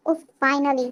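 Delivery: ordinary delivery, nothing marked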